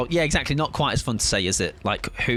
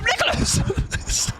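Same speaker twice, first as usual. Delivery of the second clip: much higher, slow drawn-out cry of alarm; long high breathy wail; much slower than usual. Real, not acted. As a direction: wail his name as he goes down. Teammate in danger.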